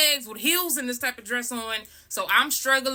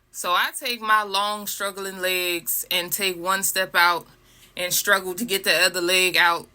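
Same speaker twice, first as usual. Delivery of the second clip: usual speaking voice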